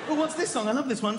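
normal talking voice

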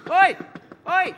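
loud cheeky shout of oi; squirrel shouting oi. Acted. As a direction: call out rudely to get attention.

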